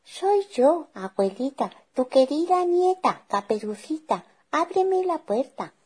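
very high fake little-girl voice; piping, put-on child voice; wolf imitating a girl. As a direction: pretend to be a sweet granddaughter. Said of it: "imitando la voz de la niña".

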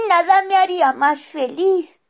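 put-on a small boy missing his family; high child voice. Rufino answers.

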